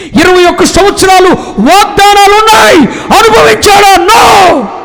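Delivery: loud, high, impassioned sermon; preacher in full flight; fiery preaching. Acted. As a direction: thunder it at the congregation.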